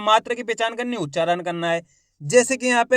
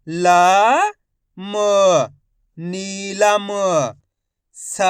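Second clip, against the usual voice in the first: long-held single letters, then very slow blended words; slow sounding out; 3 dB louder, much slower than usual. Shown as a demonstration. Teacher shows friends how to read each word, syllables drawn out. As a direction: stretch every sound, pausing between syllables. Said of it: "मैं किस तरीके से एक अक्षर का उच्चारण कर रहा हूँ".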